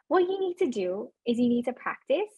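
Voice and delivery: ordinary delivery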